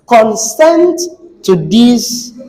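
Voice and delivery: "consent" with the second syllable pushed high and long; verb stress. Shown as a demonstration. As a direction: jump up high on the end of "consent". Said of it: "That's, it is a verb".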